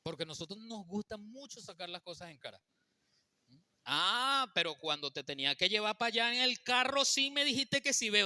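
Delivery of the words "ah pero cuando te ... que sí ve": reproachful. Acted. Man throwing favours in wife's face.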